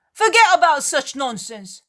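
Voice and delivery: high, loud scoff; loud dismissive retort. Acted. Peter loudly scolding the sailor's plan, scornful. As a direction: wave it off with a loud scornful bark.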